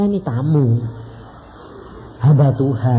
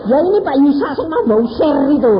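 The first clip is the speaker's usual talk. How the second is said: louder, higher excited wife; excited introduction; 9 semitones higher, 6 dB louder than usual. Retold or imitated. Wife introducing the preacher to her husband. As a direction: introduce him eagerly. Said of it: "Mama tahu ini siapa?".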